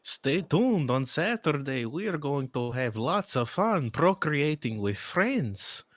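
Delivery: high, swooping mock announcement voice; hammed-up sign-off with big pitch swoops; put-on announcer. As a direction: plug the show in an exaggerated high sing-song. Put-on sign-off announcement, sets off laughter.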